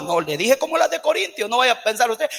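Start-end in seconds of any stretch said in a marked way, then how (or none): none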